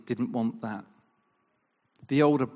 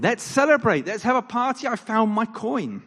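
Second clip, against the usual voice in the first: higher and louder, delighted; joyful invitation; 9 semitones higher, 6 dB louder than usual. Retold or imitated. Woman who found her coin calling neighbours to celebrate. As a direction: call friends in with glee.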